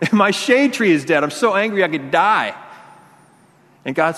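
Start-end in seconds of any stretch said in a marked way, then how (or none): 0.0-2.5 whiny Jonah. Retold or imitated. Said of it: "He's like".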